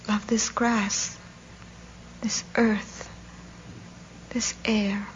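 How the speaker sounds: slow, halting plea for life; hushed, intense longing; anguished inner thought. A narrator's reading. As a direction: dwell on each phrase, clinging to life. Dying man's desperate inner thoughts.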